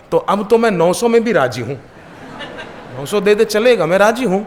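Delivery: a sleeper pleading for the god to come back; laughing plea. Retold or imitated.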